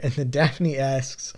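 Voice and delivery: ordinary talk, nothing marked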